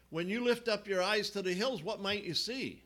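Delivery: usual speaking voice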